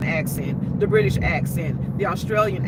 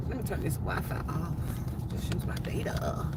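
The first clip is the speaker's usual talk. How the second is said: very low, hushed mumble; muttering to self; 6 semitones lower, 11 dB quieter than usual. Real, not acted. Host fiddling with Wi-Fi, muttering to self. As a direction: talk under your breath.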